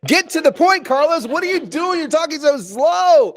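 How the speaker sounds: high, loud mock impatient yell; exasperated shout. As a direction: yell like a listener fed up with waiting. Acts out exasperated impatient audience member.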